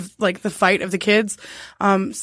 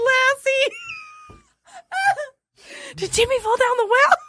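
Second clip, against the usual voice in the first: very high, squeaky mock concern; hammed-up high-pitched dog-owner voice; talking to Lassie. Quoting Lassie's owner amid hysterics.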